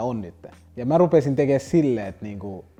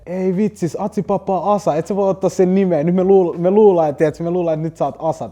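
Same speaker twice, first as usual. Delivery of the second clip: a raised, loud, high protest building in force; heated protest; 7 semitones higher, 7 dB louder than usual. Retold or imitated. Rapper scolding the kid over Asa's name, loud and high. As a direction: complain hard that he stole the name.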